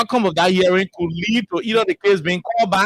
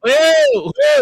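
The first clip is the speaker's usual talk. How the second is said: loud, high hey, hey to cut in; calling out hey; 5 dB louder than usual. Real, not acted. Host cuts in on the panelist with a loud high hey. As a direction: shout hey to grab the floor. Host shouting over caller.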